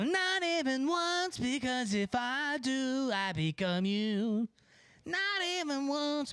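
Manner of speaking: high, slow, drawn-out put-on refusal; mock stern refusal. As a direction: refuse the gargoyle with hammed-up, stretched-out words. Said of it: "But I go".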